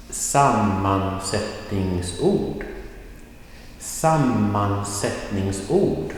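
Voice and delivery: "sammansättningsord" stretched out, piece by piece; slow pronunciation. Shown as a demonstration. Teacher reading "sammansättningsord" off the whiteboard for learners, slow pronunciation. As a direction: model "sammansättningsord" slowly, lifting the end. Teacher models written term slowly.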